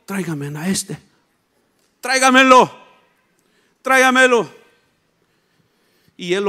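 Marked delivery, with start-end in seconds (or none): 0.1-4.5 loud, short summons; calling out command. Acted. Jesus telling the religious men to bring him, calling out. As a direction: order them to bring him over. Jesus calls for the blind man.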